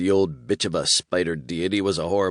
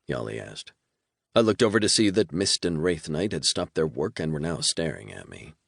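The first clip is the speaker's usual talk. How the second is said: usual speaking voice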